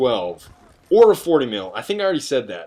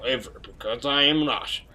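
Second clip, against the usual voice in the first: higher hammed-up Russian accent as the medic; a grumpy Russian medic in a put-on Russian accent; mock Russian accent; 4 semitones higher than usual. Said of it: "He's like".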